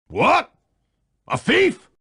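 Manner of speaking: shocked high yelp of alarm; surprised. Acted. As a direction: blurt it out, stunned and loud. Learns of a thief.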